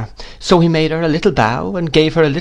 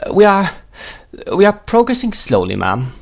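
lightly voiced hesitant doctor, higher pitched; a hint of timid, halting deference, dropping on "ma'am"; meek doctor; 9 semitones higher than usual. A narrator's reading. Timid doctor reporting to aunt.

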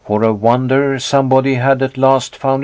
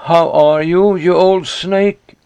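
louder, sneering greeting; contemptuous; 6 semitones higher, 3 dB louder than usual. A narrator's reading. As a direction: spit out the insult. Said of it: "smiling contemptuously".

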